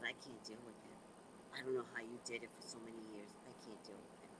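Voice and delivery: very soft, low, defeated sister; worn-out complaint. Retold or imitated. Sister phones about taking over Dad, worn out and hushed. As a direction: grumble it weakly, barely audible.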